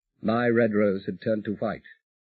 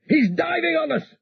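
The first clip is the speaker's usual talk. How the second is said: much higher, frantic warning cry; urgent panicked shout; 4 dB louder than usual. A narrator's reading. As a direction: yell the alarm as guns open up. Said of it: "harsh, urgent shout from Pete".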